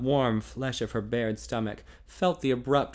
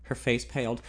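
usual speaking voice

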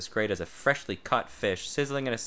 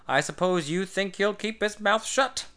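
high, sarcastic teasing voice; higher mocking sarcasm; sarcastic tease; 8 semitones higher than usual. A narrator's reading. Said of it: "he teased car-, sarcastically".

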